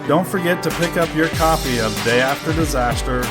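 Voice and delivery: ordinary talk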